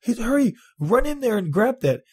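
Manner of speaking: high urgent imitation of his boss. Imitating his boss sending him into the closet, high urgent. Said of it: "And she's like".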